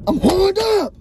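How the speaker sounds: high whiny plea; comic pleading whine. Acted. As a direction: whine it like a needy kid. Comic pitch for attention.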